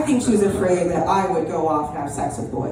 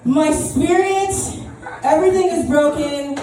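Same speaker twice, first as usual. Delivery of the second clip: much higher, dramatic mock whine; hammed-up victim; 9 semitones higher than usual. Comic pretending to milk the car crash, hammed-up wail. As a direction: overplay the injured victim. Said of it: "I would have been like".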